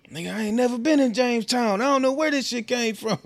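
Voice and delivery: high-pitched mock protest; indignant denial. Accused partner denies visiting Jamestown, high indignant protest.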